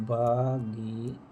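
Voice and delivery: भागीरथी drawn out very slowly, long आ in भा held; भागीरथी said slowly, leaning on the long भा; slow pronunciation demo. Shown as a demonstration. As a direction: stretch भागीरथी so students hear the long आ. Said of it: "भागीरथी होती है".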